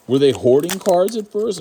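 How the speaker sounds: mock indignant. Mocking the rule for Canadians.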